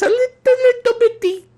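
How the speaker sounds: very high, squeaky little voice; teeny-tiny voice. Acted. Host showing how small the router is, teeny high voice.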